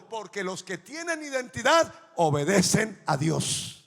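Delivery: loud, building preacher's shout; fiery sermon shout; full-force preaching. Acted. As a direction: drive it home to the congregation.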